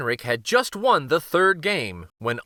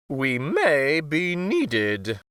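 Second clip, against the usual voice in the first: very slow, solemn warning; grave queen; 2 semitones higher, much slower than usual. Acted. Queen to the guards, slow solemn warning. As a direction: drag it out portentously. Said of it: "the queen said".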